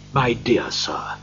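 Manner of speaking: slow, subdued, grateful voice; moved gentleman. A narrator's reading. Gentleman shaking hands with Scrooge, slowly and moved. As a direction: say it slowly, as if overcome.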